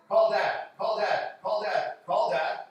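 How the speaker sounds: loud, evenly repeated chant; insistent repeated command. Retold or imitated. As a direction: imitate an urgent message drumming in the ear. Imitates insistent voice in his ear.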